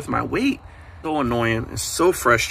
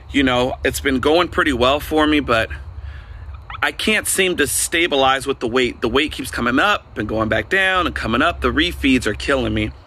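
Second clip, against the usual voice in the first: ordinary talk, nothing marked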